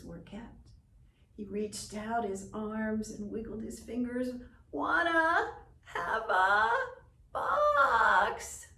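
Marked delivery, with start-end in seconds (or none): 4.7-8.7 put-on high, far whiny baby cry; whining baby raven. A narrator's reading. Baby Raven whines to his grandfather. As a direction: wail it like a fussy baby bird.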